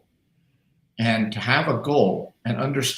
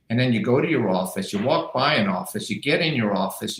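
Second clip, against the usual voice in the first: normal talking voice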